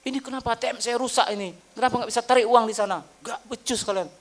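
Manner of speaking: high, loud, fast complaint; angry customer. Retold or imitated. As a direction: blast the bank staff like a furious client. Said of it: "begitu datang".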